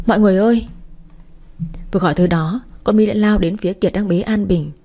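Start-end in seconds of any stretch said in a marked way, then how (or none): 0.1-0.7 mildly raised call for help; narrated cry for help. A narrator's reading. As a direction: call out to everyone, with restrained urgency. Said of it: "Tôi một mạch chạy ra ngoài gào lên".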